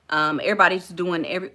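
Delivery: normal talking voice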